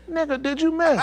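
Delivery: high-pitched, disbelieving question; incredulous officer. Retold or imitated. Retold officer confronts the comic, high incredulous voice.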